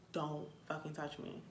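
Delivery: quiet warning muttered at a stranger; low warning. Retold or imitated. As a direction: say it under your breath, menacing.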